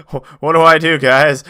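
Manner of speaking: hammed-up helpless cry; mock helpless. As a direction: appeal to the viewers in play panic. Mock helpless appeal to viewers.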